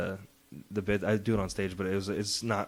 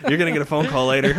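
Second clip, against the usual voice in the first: higher, louder, words breaking into laughter; laughing retold warning; 5 semitones higher, 8 dB louder than usual. Comic retelling his school donut trouble to co-host, laughing. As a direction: laugh through the quoted warning.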